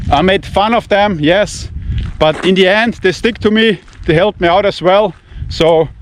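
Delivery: ordinary talk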